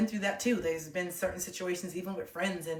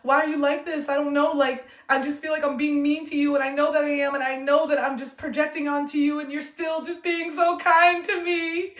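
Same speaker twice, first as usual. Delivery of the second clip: retold crying; 9 semitones higher, 9 dB louder than usual. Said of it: "I'll start breaking down crying".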